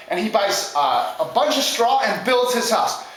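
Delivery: ordinary delivery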